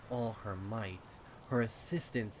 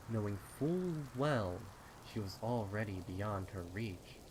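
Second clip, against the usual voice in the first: ordinary delivery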